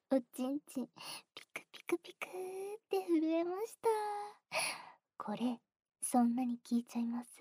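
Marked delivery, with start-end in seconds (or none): none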